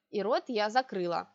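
ordinary delivery, nothing marked

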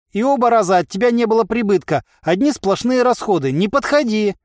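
high, agitated complaint; fretful merchant's protest; exasperated rebuff. A narrator's reading. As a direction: scold and warn off in a high voice. Merchant rebuffs the unprofitable giant.

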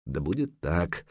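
soft, slow, inward assent; inner thought. A narrator's reading. Санёк silently agrees with the jarl, softly. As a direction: think it aloud quietly. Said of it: "подумал Санёк".

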